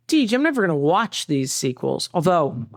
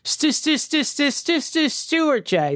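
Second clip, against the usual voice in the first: put-on stuttering child actor; 8 semitones higher, slower than usual. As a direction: fake stutter, higher. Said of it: "They would stutter more".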